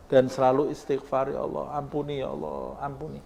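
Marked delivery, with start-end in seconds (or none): 1.3-3.2 soft, fading plea for forgiveness; tearful prayer. Acted. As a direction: beg quietly, as if near tears. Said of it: "Sehingga kamu menangis". (doa lirih penuh penyesalan)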